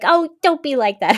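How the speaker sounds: imitated nurse consoling her; tender nurse. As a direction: soothe a crying new mother. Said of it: "And she was like".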